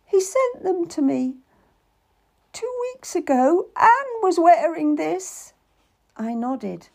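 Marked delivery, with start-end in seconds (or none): 0.0-5.5 put-on high, troubled girl; uneasy young lady. A narrator's reading. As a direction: dread under the words.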